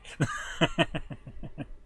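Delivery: soft, breathy chuckling; real run of voiceless chuckles; self-amused laugh. Real, not acted. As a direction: laugh quietly at your own pomposity. Amused at choosing the pompous method.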